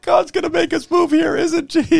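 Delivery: high, loud hammed-up wail of the wife; mock crying wife. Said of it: "she starts crying in the service".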